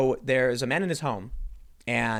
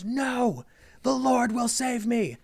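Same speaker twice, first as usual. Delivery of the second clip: stubborn believer; 10 semitones higher, much slower than usual. Retold or imitated. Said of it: "And he goes".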